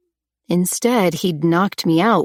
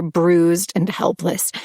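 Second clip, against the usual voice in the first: usual speaking voice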